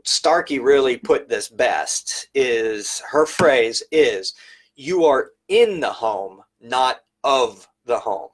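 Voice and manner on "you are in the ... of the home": in and of leaned on, slow and deliberate; stress on in and of. Shown as a demonstration. Quoting Starkey's phrase with slow stress on in and of. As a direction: lean on in, then of, slowly. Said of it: "those two emphasized words are very important".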